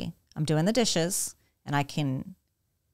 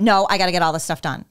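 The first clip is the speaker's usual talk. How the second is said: hurried brush-off; 2 semitones higher, 5 dB louder, faster than usual. Retold or imitated. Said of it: "And I'm".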